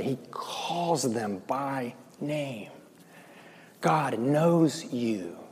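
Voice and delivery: slow, soft and tender, almost hushed. Acted. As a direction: lean in and say it gently, very slowly. Tender point about being known.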